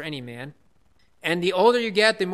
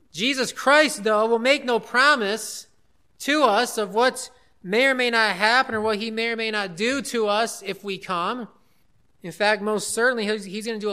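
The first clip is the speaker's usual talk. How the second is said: full-force preaching; 4 semitones higher, 5 dB louder than usual. Real, not acted. Pastor to the congregation, preaching with rising force. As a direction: drive the point home to the congregation.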